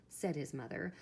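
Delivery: ordinary delivery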